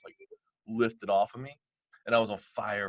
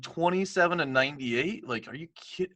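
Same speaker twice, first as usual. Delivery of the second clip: incredulous; 10 semitones higher, 4 dB louder than usual. Retold or imitated. Stunned by the IRS bill.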